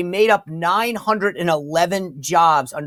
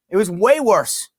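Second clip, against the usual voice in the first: ordinary delivery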